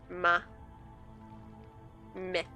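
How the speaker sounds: ordinary delivery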